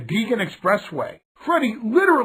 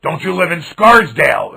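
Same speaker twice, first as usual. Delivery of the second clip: louder, brash wrestler bark; gruff wrestler voice; 3 semitones lower, 8 dB louder than usual. Retold or imitated. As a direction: call out to the kid like a tough old wrestler. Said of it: "comes to me and he says".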